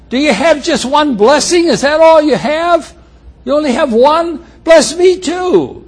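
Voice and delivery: high, pleading, near tears; pleading Esau. Acted. Esau pleading with his father, high and tearful. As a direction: beg for the one blessing. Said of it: "he starts to cry".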